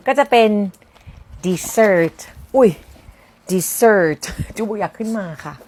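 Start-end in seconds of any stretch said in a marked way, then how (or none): 1.4-5.5 dessert drawn out very slowly, stress on the second syllable; slow teacher demo of dessert; slow model word. Shown as a demonstration. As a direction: stretch out dessert for learners. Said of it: "พูดว่า dessert อันนั้นจะแปลว่าทะเลทราย".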